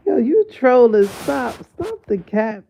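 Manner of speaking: loud disbelieving shout at the doctor; outraged yell. Real, not acted. Vlogger yelling at the gynecologist's caption, outraged. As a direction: yell stop, you can't be serious.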